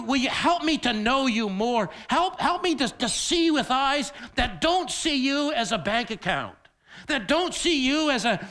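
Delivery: loud, lifted pleading prayer; fervent prayer. Acted. Prayer voiced aloud.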